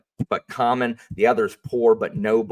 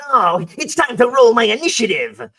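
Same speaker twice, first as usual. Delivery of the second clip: much higher, louder annoying nerd voice; nerdy tyrant voice; 10 dB louder than usual. Retold or imitated. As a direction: play the incel nerd villain, grating. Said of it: "he talks like this".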